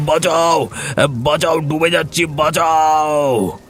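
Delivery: high, desperate drowning cries; panicked, stretched-out calls for help; crying for help. Acted.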